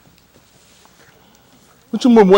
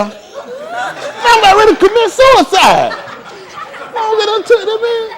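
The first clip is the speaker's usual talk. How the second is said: mock despair; 4 dB louder, much slower than usual. Mock despair while laughing.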